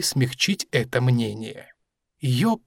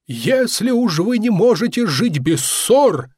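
louder, stern rebuke; stern professor; 6 semitones higher, 4 dB louder than usual. A narrator's reading. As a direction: scold them sternly like a professor. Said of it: "продолжал профессор уже менее суровым тоном". (строгий тон профессора)

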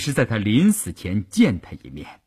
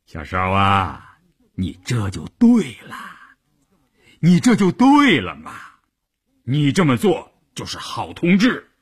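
pat her on the shoulder with your voice; affectionate. Acted. Commander coaxes his wife, warm and chuckling. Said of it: "亲热地说".

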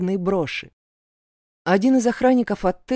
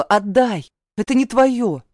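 loud, higher insistent demand; 4 semitones higher, 6 dB louder than usual. A narrator's reading. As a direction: demand it back, loudly. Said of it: "повторила". (громко требуя)

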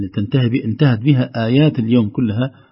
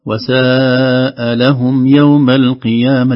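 وساء لهم recited loud and very slow with a long held "saa"; drawn-out recitation; 3 semitones higher, 6 dB louder, much slower than usual. Shown as a demonstration. Teacher giving the host an example verse, slow recitation. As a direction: chant it slowly, stretching the long vowels. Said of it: "حتى الأداء الصوتي كذلك".

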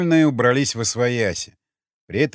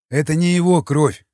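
raised, mildly amused correction; higher, louder, touch of irony; wry smirk; 5 semitones higher, 6 dB louder than usual. A narrator's reading. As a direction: put them right with a smirk. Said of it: "усмехнулся Крест".

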